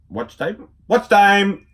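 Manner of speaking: rising to a loud high shout; excited shout. Retold or imitated. Kid retelling a cartoon, shouting for the watch.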